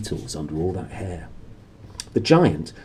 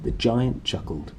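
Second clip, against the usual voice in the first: plain reading voice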